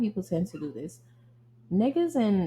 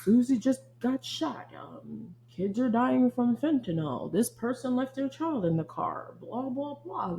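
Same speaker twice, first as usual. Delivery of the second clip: higher, slower mock newsreader voice, reeling off bad news; mock news anchor; 4 semitones higher than usual. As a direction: parody the anchor listing one tragedy after another. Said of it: "have you ever just watched the news".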